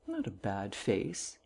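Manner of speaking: low, soft, slow private thought; slow, hushed inner musing; musing to himself. A narrator's reading. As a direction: reflect quietly on your own face. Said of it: "he reflected".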